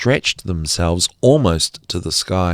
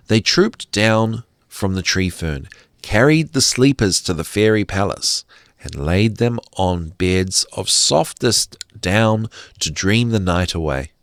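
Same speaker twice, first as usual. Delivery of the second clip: usual speaking voice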